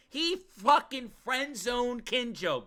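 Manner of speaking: high, drawn-out protest; outraged disbelief. Real, not acted. Streamer complaining to chat about Yuki, high slow outrage. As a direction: stretch it out in high indignation.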